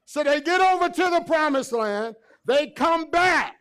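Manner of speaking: loud, high, fervent preaching; preaching at full force. Acted. Preacher to congregation, full-force preaching. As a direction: lift the room with it.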